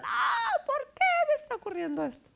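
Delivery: high, fast imitated cry of despair; a mock anguished wail, pitched far up. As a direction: act out someone wailing why me. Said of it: "hay quienes gritan".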